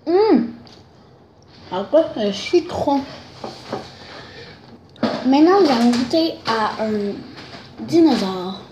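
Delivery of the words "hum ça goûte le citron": chewing while talking; muffled by food in mouth; mouth full of candy. Real, not acted. Seen 1: bites candy slice at 0.6-1.3, then speaks while chewing.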